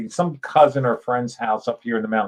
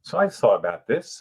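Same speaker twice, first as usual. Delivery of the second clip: ordinary talk, nothing marked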